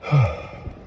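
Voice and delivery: breathy exhaled sigh; heavy sigh. Real, not acted. Man recounting an alarming text, heavy sigh. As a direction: let out a sigh mid-story.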